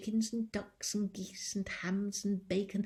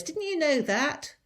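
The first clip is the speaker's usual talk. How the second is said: high, louder rising taunt; mocking question; 10 semitones higher, 8 dB louder than usual. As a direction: needle her with it. Mrs Bean's sharp retort.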